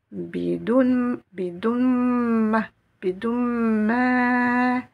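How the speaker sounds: "bidoun" run into "ma", the n swallowed into m, rising in pitch; assimilation drill. Shown as a demonstration. Teacher drilling students on the linked "bidoun ma". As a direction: chant "bidoun ma" with the n merged into the mim. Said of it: "On va assimiler le son on".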